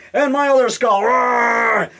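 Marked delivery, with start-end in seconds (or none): 1.0-1.9 shouted monster growl. Acted. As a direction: roar at the viewer like a monster. Skull toy attacking viewer.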